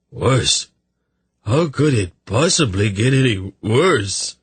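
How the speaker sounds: mildly aghast, pitch rising on the question; a touch of miserable disbelief; dismayed. Acted. Hungover man hears it gets worse, mild dismay.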